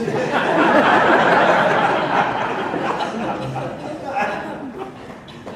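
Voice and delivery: a long breathy laugh at his own joke; laughing at the Star Trek gag; amused laugh. Real, not acted.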